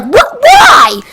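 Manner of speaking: very high, loud what and why; shocked yelp. Retold or imitated. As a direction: shriek it in disbelief. Kid shocked by 911 threat.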